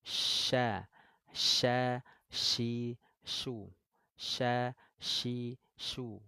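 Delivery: sha shi shu with spread, airy sh; shin drill. Shown as a demonstration. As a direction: drill the sh syllables slowly. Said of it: "menyebarnya angin di dalam mulut".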